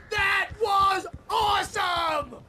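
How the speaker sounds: loud, thrilled cheer, drawn out slowly; excited shout. Acted. Barry cheering to Al after the fireworks, excited shout. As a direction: cheer the win.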